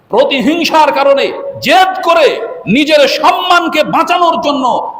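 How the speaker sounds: high, slow, emphatic preaching shout; impassioned shout. Real, not acted. Preacher explaining why brother killed brother, impassioned shout. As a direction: cry out each phrase with drawn-out force. Preacher repeats the motive forcefully.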